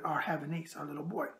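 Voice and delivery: usual speaking voice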